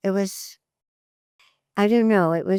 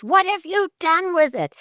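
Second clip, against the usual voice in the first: angry demand; 12 semitones higher, 4 dB louder than usual. Retold or imitated. Roommate finds hidden bread.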